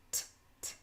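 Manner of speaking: crisp unvoiced "T, t." with no vowel after; clean t sound. Shown as a demonstration. Teacher modelling the t sound, crisp and clean. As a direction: clip the "t" short, no uh. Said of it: "Yes, t is the sound".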